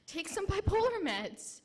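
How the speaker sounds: mock the specialist's blunt verdict; dismissive doctor. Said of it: "and said".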